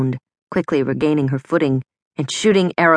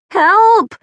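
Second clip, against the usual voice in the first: high, loud desperate cry; terrified hotel guests crying out; cry for help; 6 dB louder than usual. A narrator's reading. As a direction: scream for help as the glass shatters. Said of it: "the guests cried in unison".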